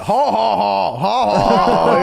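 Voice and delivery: slow, drawn-out "ha, ha, ha"; deliberate fake belly laugh; mock laugh. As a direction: imitate a big slow guffaw. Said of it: "He laughed like that".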